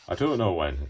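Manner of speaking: normal talking voice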